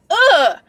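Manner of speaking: sharp high aggressive grunt; short fierce uh. Retold or imitated. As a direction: hit it like a fierce face. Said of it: "just like that".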